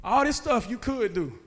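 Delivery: ordinary talk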